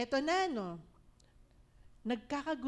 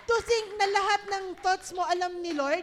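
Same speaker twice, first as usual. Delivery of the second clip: high and loud, driving the point home; preaching at full force; 7 semitones higher, 9 dB louder than usual. Real, not acted. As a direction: proclaim it to the whole church. Preacher raises voice to congregation. (malakas na pangangaral)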